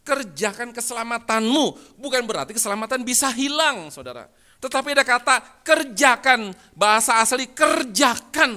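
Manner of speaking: loud, high, declamatory preaching; impassioned pulpit shouting; preaching at full force. Real, not acted. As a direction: proclaim it to a big hall, stretching key words.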